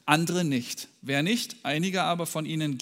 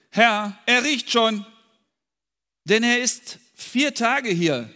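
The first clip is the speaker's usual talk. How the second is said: doubting complaint; 7 semitones higher, 7 dB louder, slower than usual. Acted. Martha's doubting words in Jesus story.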